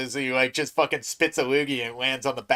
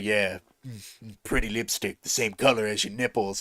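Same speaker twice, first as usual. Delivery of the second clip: softer, lightly voiced come-on; mock sleazy seducer; 5 semitones lower, 7 dB quieter than usual. Imitating Riddick's sleazy line to the woman, soft. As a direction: purr it like a cheesy tough guy.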